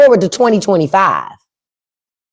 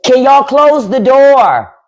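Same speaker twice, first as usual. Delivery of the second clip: higher, louder and slow, aimed across the house; calling out; 5 semitones higher, 3 dB louder, slower than usual. Real, not acted. Streamer calling to family to close the door, loud. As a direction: call out to the next room.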